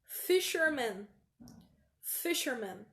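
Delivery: Fisherman said slowly and clearly; slow model Fisherman; slow model pronunciation. Shown as a demonstration. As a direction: Fisherman drawn out for the class. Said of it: "Próxima palavra".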